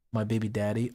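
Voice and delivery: normal talking voice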